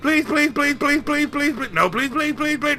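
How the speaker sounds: high, nagging please on repeat; singsong pleading to wear him down; whiny begging. Acted. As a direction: pester him childishly. Player pesters banker relentlessly.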